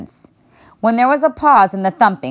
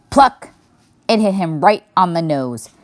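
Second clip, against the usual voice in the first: usual speaking voice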